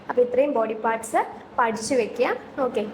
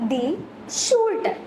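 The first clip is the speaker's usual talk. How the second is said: raised repeat; 5 semitones higher, much slower than usual. Shown as a demonstration. Teacher repeats German for shoulder higher, prompting the class. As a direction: lift ഷൂൾഡർ high so the class repeats it. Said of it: "എന്താ പറയുന്നത്?".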